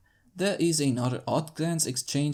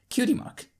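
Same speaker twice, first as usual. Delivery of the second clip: awkward realization; 12 semitones higher than usual. Acted. Covering awkwardly.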